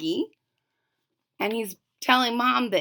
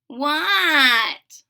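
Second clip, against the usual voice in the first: louder shouted reply; called back answer; 5 semitones higher, 6 dB louder than usual. Acted. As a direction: yell back from outside. Froggy answers mom's call.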